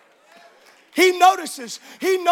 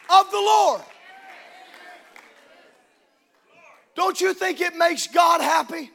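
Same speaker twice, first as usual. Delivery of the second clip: rousing sermon climax; fiery preaching; 3 semitones higher than usual. Acted. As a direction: preach it out to the whole church. Preacher at full force.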